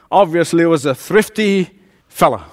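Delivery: ordinary delivery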